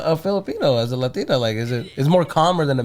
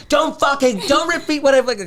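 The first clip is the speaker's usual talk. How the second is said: put-on annoyed girlfriend; 10 semitones higher than usual. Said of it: "And she hates that".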